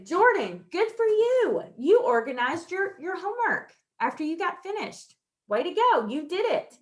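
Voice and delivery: high, loud cheer for a student; teacher praising a boy's homework; enthusiastic praise. Shown as a demonstration. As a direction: praise him with gusto. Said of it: "I wasn't so as dramatic".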